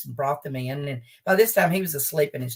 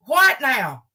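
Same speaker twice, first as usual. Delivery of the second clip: aggravated husband; 8 semitones higher, 5 dB louder than usual. Retold or imitated. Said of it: "He get a little aggravated".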